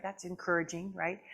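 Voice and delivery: usual speaking voice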